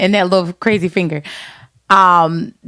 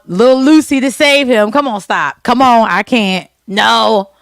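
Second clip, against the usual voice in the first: ordinary talk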